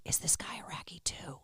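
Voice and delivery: whispered under the breath; secretive whisper to a companion; hushed aside. Retold or imitated. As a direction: lean in and whisper it covertly. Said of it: "leaned over to you or something and said".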